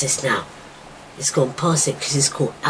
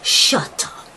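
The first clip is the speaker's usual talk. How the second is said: hushed, whispered warning; whispered shut up; slower than usual. Retold or imitated. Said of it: "that's when I say".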